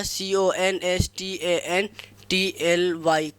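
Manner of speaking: slow dictation of ए एन जी ई आर; spelled out letter by letter. Shown as a demonstration. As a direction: spell ए एन जी ई आर slowly, each letter apart. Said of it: "constantly हो गया". (धीरे-धीरे अक्षर-अक्षर बोलना)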